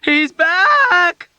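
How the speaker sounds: shrill high scream of panic. A narrator's reading. Dunbar screaming to the ward in terror. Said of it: "Dunbar screamed again".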